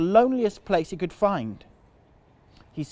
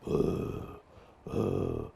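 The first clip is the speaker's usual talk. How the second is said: Clive breathing; 9 semitones lower, 7 dB quieter than usual. Shown as a demonstration. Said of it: "his best Clive impression".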